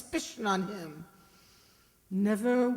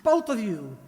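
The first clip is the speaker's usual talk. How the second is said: commanding order; 5 dB louder than usual. Acted. Woman with gun commands them.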